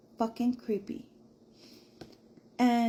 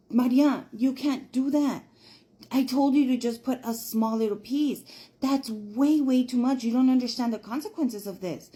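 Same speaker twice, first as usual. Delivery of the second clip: loud, higher reprimand to Maria; retold scolding; 3 semitones higher, 6 dB louder than usual. Narrator scolding Maria for using too much, loud. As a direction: tell her off, loud and exasperated.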